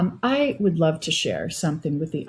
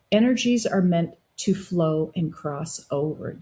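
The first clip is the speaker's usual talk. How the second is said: normal talking voice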